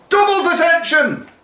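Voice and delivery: very high, loud shrieked punishment; furious teacher bellowing. Acted. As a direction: bellow "Double" hard and high. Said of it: "bellowed Missus Watkins".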